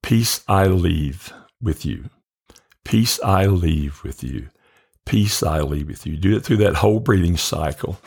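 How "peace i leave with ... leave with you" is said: slow, unhurried, one phrase per breath; slow breath-paced repetition. Shown as a demonstration. Teacher shows listeners breathing through the verse, slow and calm. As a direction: let each word ride a slow breath. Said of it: "As you breathe in, you go".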